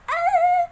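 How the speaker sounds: shrill excited squeal; high squeal. Real, not acted. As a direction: let out a loud high squeal. Outro exclamation.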